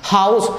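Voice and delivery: हाउस said with the h pushed from the belly; हाउस with a strong belly h; pron demo. Shown as a demonstration. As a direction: breathe हाउस out from the stomach. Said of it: "देखिए कैसे पेट काम कर रहा हमारा".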